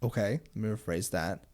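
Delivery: ordinary delivery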